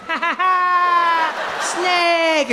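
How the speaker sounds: put-on high, drawn-out kid's laugh and cry; a little boy squealing with glee, high and stretched out; excited kid. French boy at the snake show, excited high squeal.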